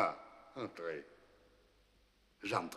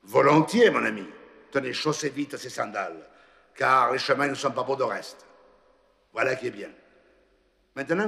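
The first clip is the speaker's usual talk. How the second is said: lightly voiced saint, louder, calling out toward the far road; Saint Peter's directions; 3 semitones higher, 6 dB louder, faster than usual. A narrator's reading. Saint Peter pointing the priest down the road, louder and brisk. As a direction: send him on his way with brisk cheer.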